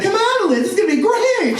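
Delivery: high, laughing plea to a friend; playful coaxing. Retold or imitated. Boy coaxing his reluctant classmate, laughing and high. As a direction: coax her along with a laugh.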